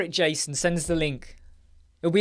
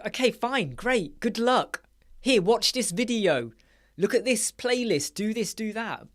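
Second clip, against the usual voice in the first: higher, breezy brush-off; dismissive brush-off; 7 semitones higher than usual. Retold or imitated. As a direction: wave them off with mock cheer. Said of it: "I'm like".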